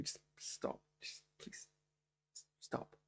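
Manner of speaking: soft, breathy whisper; whispered plea. Real, not acted. Reviewer whispering to fans to please stop. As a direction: hush it to a pleading whisper.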